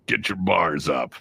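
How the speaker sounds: slow drawn-out hype line; mixtape voice line. Retold or imitated. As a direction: stretch it slow like a mixtape drop. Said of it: "my random voice going".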